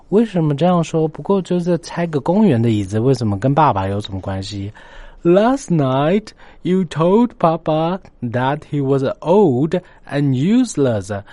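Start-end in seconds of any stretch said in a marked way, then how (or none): 5.2-11.2 put-on higher, louder, slow little boy's complaint; upset child. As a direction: tell mom what she said, like a hurt child. Child accuses mother in story.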